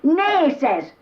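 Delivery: high, loud outraged protest; indignant shout. Acted. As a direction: cry it out, scandalised. Edgar's indignant reply to his pal.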